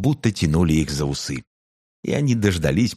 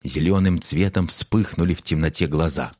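ordinary delivery, nothing marked

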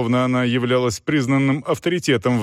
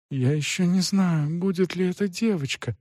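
soft, higher, innocent voice; naive reply; 7 semitones higher, 4 dB quieter than usual. A narrator's reading. The innocent mother answering the aunt, soft and naive. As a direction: answer sweetly, not getting it. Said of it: "наивно сказала моя мать".

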